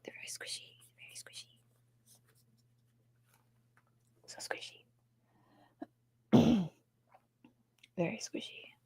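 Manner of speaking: whispering about the squishy toy; hushed delighted whisper. Real, not acted. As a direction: breathe the words, fading away.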